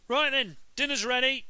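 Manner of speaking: cheery dad summoning the family; dad calling out. A narrator's reading. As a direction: call everyone to the table. Dad calls family to table.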